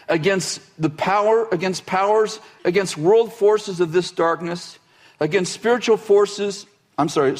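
measured reading of scripture. Acted. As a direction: read the passage aloud with weight. Reads Ephesians passage aloud.

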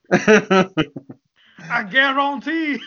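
very slow Cajun I guarantee; Cajun accent. Pretend. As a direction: stretch it out like a Cajun cook. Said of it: "you sound like a Cajun".

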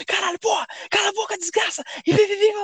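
high, squeaky mock rant; furious outburst. Player exploding at his teammate, retold as high-pitched angry rant. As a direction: rage in a high voice, collapsing into gibberish. Retold quarrel climaxes in cursing.

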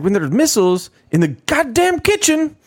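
high, loud, heated rant; angry president. Retold or imitated. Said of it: "he was getting mad at me".

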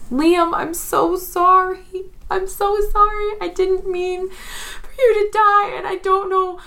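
high-pitched, wailing sobbing plea; crying apology. Acted. Skylar to dead Liam returning, tearful high wail. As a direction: beg forgiveness through tears, voice way up high. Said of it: "I think she's crying".

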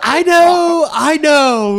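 imitating mom, high and loud; high-pitched, drawn-in protest of a scolded mom; exasperated admission. Mom conceding to her son that it was a scam, exasperated.

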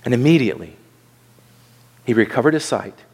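low-pitched, deliberate reading with a long pause; unhurried verse, pausing after "immediately"; measured scripture reading. Real, not acted. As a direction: read it out solemnly, phrase by phrase. First solemn reading of the verse.

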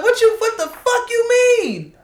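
high, loud outraged snap. Retold or imitated. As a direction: go off in disbelief. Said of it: "I'm like".

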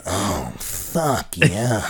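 low odd voice, drawn out; weird voice imitation. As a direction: mimic the Frogman's voice. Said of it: "You sound like Kenny Kniff".

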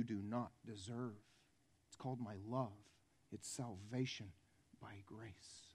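soft, low, gentle appeal; tender pleading. Acted. God holding out salvation to the listener, tender pleading. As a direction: plead softly, fading almost to nothing. In front of you and saying.

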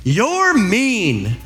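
put-on high, drawn-out "you're mean" from a kid; whiny imitation of an accusing child. Child accusing a sibling, mock high-pitched accusation. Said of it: "they immediately say".

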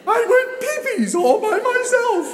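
shrieked high through laughter; laughing, crying repeat. Retold or imitated. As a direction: echo the child in loud hysterics. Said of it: "I'm laughing, I'm crying".

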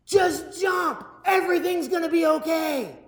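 high, shouted sergeant's command; shouting drill sergeant. Retold or imitated. Drill sergeant yelling up high at the frozen recruit. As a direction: bark it down from the clifftop. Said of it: "he goes".

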